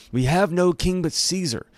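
high shouted retort; shouted back. A narrator's reading. Leading priest answering Pilate, shouted back high. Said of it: "the leading priest shouted back".

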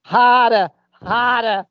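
loud, high mock moaning Boston; hammed-up Boston moan; Boston accent. Boston orgasm joke.

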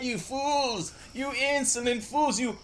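high villainous gloating, building loud; Ursula. Acted. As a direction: gloat like a sea witch.

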